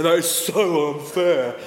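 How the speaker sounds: put-on slow, moping teenage whine; sulky teenager whine. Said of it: "come in with his arms ... and say".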